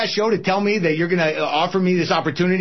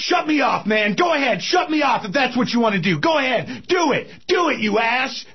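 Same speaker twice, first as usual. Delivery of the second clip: higher, shouted challenge; angry shout; 5 semitones higher than usual. Acted. Host shouts at executive during quarrel, angry and higher. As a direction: yell it right in his face.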